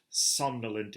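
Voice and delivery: ordinary delivery, nothing marked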